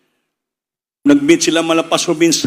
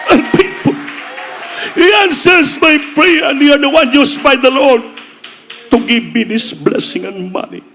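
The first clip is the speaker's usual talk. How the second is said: much higher, slow, loud preaching cry; high shouted cry of gratitude that eases off at the end; overwhelmed testimony; 12 semitones higher, 4 dB louder, slower than usual. Retold or imitated. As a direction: proclaim it, nearly crying out to heaven. Pastor answers through tears, overwhelmed.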